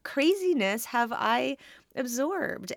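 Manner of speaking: high, quick amazed self-quote; waking realization. Retold or imitated. Waking from the dream, retelling her own amazed realization. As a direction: replay the sudden relief of waking up. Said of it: "I woke up and I was like".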